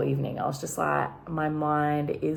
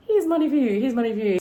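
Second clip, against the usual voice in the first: high, louder imitation of the host; host handing out money; 7 semitones higher, 5 dB louder, faster than usual. Host hands out lap dance money, light high mimicry. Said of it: "it was like".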